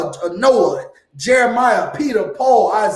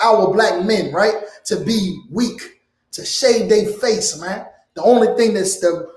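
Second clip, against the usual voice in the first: ordinary talk, nothing marked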